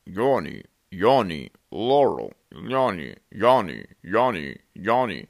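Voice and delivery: machine-like falling Yanni; computer voice Yanni. Shown as a demonstration. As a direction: demo the other hearing. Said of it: "two distinct sounds".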